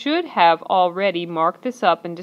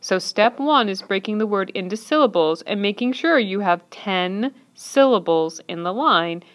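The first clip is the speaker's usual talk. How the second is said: normal talking voice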